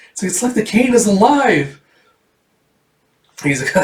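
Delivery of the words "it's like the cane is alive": put-on high, wondering girl; amazed little-girl voice; acted amazement. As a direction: marvel in a child's high pitch. Erica amazed by moving cane.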